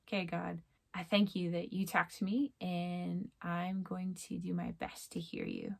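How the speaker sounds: soft closing prayer, slowing at the end; quiet prayer. Real, not acted. Speaker closing her video with a quiet prayer to God. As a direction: pray it gently, just between you and God.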